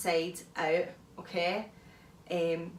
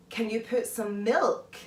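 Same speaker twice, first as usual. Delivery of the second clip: slow, every syllable crisp, leaning on "milk"; over-articulated. Shown as a demonstration. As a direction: spell out "Can you put some milk?" carefully. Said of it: "really emphasise the M I L K".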